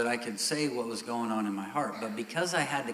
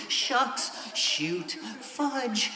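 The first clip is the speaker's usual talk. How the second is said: high mock-polite little oaths; imitating a prim churchgoer's pretend cussing; squeaky minced oaths; 10 semitones higher, much slower than usual. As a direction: pipe up with prim, harmless cuss substitutes. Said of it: "you'll walk around going".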